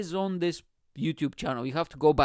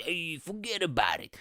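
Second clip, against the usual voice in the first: full Italian-American. Acted. Comic teasing an Italian-American fan, exaggerated Italian-American accent. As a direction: caricature Italian-American wise guy accent. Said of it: "this full fucking Italian American".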